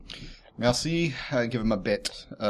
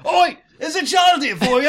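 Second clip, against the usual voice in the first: shouting innkeeper; 13 semitones higher than usual. Acted. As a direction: bellow up to the room.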